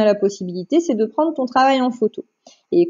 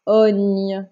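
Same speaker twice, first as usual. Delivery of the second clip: lower, drawn-out syllable; dictation; 4 semitones lower than usual. Shown as a demonstration. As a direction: dictate it clearly and evenly. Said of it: "Je répète".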